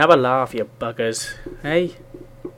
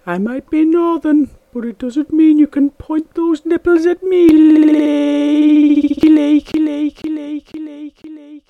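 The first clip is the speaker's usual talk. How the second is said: higher put-on comic voice, fading at the end; high character voice; 8 semitones higher, slower than usual. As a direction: squeak it out, then fade the repeats.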